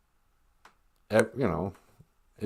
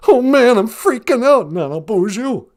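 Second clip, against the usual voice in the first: loud, higher-pitched panicky lion caller; freaking-out Cowardly Lion; 12 semitones higher, 8 dB louder than usual. Acted. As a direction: play a jittery lion phoning in a panic. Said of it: "it's the Cowardly Lion".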